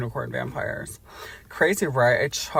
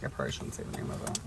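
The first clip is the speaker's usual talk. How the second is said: hushed whisper to self; 13 semitones lower, 13 dB quieter, faster than usual. Retold or imitated. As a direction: whisper it under your breath. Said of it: "but I was like".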